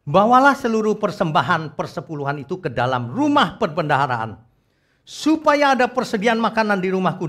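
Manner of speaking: high, loud proclaimed Bible verse; declamatory scripture reading. Shown as a demonstration. Preacher reading Malachi to the congregation, declamatory. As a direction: proclaim the promise with rising force.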